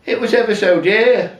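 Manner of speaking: high, loud indignant plea; pleading protest. Retold or imitated. Husband protesting to his wife about the price, indignant. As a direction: remind her what it cost, aghast. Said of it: "But I said".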